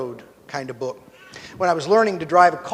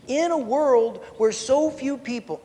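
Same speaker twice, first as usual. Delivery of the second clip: higher, slow preacher build-up; getting preachy; 7 semitones higher, slower than usual. Pretend. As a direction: start the sermon voice, drawn out. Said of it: "I'm sorry, I'm getting preachy".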